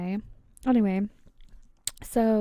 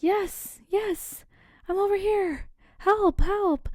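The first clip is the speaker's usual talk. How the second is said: high, desperate cries for help; calling for help; 10 semitones higher, 4 dB louder, slower than usual. Acted. Limbless victim calls from the bush for help, high and desperate. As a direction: yell out to the rescuer, pitched way up.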